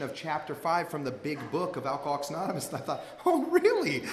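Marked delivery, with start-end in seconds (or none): 3.3-4.0 very high, incredulous squeak; mock amazement. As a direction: feign astonishment way up high. Mock reaction to big book.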